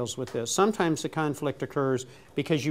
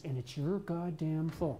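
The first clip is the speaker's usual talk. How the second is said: softer, slow, deadpan wife retort; wife's cutting retort; 11 dB quieter than usual. Retold or imitated. Wife blaming husband for his bad day, quiet deadpan. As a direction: turn it back on him quietly.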